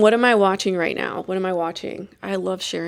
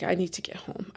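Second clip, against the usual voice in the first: urgent whisper; 8 dB quieter than usual. Retold or imitated. Retold panic, wanting home.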